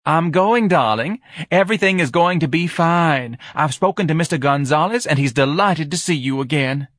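high, loud, over-reassuring wife; loud and high, smothering reassurance; gushing wife. A narrator's reading. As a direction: coo encouragement loudly in a high voice.

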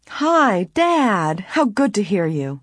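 happy. A narrator's reading. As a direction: greet Dad gladly, pitch leaping up then settling. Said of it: "Nancy said happily".

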